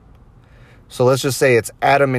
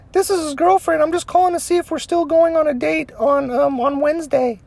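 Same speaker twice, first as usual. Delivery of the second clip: much higher sugary falsetto; fake girlfriend voice. Prank caller posing as the husband's girlfriend, high falsetto. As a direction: purr it like a sweet mistress.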